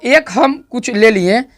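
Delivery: ordinary talk, nothing marked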